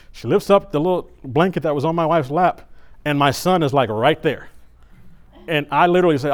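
ordinary talk, nothing marked

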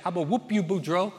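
a mild Cajun threat called across the bayou; Cajun accent. Acted. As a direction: Clarence yelling over the bayou in a light Cajun accent. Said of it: "excuse my Cajun accent".

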